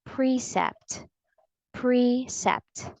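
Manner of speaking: precept as PREE-sept, first syllable stressed; correct long E. Shown as a demonstration. Teacher gives the correct pronunciation, long E. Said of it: "The correct pronunciation of this word is".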